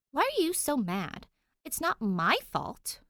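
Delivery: high, loud, indignant protest; angry outburst. Acted. Danny lashing out at the cop, loud and indignant. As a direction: snap back hurt and furious.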